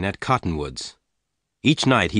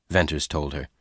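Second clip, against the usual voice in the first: plain reading voice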